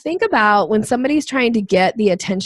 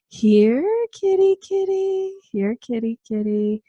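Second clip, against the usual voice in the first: cat-calling voice; 4 semitones higher than usual. Shown as a demonstration. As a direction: coax a cat out gently. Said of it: "if you play a little bit more like".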